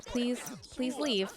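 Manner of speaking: normal talking voice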